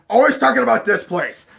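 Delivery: loud, high mimicking voice; mock complaint. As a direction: shout it like an imitated complainer. Mimicking someone complaining loudly.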